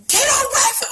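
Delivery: high, loud panicked scream. Retold or imitated. Woman screaming at her attacker to get away, high and loud. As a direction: shriek it to drive him back. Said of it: "I just started screaming".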